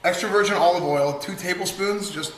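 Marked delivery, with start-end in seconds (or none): none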